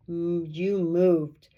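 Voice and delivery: low, very slow accusing wolf; growing suspicion, drawn out low; gruff wolf. A narrator's reading. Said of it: "in a gruff voice".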